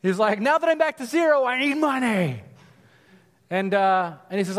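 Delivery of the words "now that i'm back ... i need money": mock demanding servant. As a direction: play the greedy servant demanding his money. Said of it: "demanding his money".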